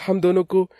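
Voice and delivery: put-on high-pitched, wheedling boyfriend; emotional pleading. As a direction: guilt your girlfriend into agreeing. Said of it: "चिराग की emotional बातों".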